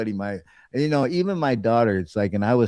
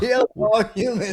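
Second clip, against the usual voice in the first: laughing robot voice; 9 semitones higher than usual. Pretend. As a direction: crack up mid robot line. Repeated laughing, louder.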